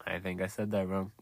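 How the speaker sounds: ordinary talk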